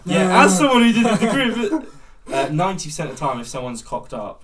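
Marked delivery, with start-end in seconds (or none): 0.0-1.7 laughing while talking. Real, not acted. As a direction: chuckle through the words. Laughing through own remark.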